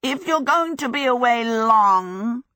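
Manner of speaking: high, loud, drawn-out creature voice; wheedling fire demon. A narrator's reading. Calcifer stopping Sophie at the door, wheedling demon. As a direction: stretch it out like a needy demon.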